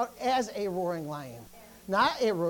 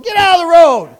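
yelling; 5 semitones higher, 20 dB louder than usual. Acted. Driver yelling at roadkill in the road, pointless yell. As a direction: yell it like chasing something off. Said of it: "you start yelling at the road".

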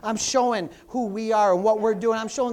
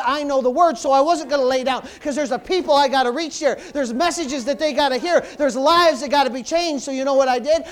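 rising to a loud preaching fervor; climbing sermon shout; building preaching; 4 semitones higher, 8 dB louder than usual. Real, not acted. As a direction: preach it harder and louder line by line. Preaching builds to full force.

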